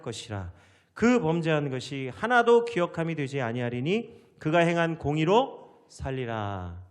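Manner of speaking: loud, declaimed Bible reading; sustained, forceful recitation from the pulpit; scripture reading. Acted. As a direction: proclaim the verses to a congregation. Reads scripture aloud to congregation.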